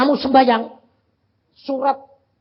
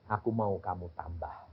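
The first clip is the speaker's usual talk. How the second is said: quiet God; 10 semitones lower, 11 dB quieter than usual. Retold or imitated. God's quiet answer.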